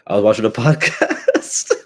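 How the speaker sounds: real laugh breaking into the words. Real, not acted. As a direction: crack up on the last word, pitch climbing. Cracks up admitting watching a podcast.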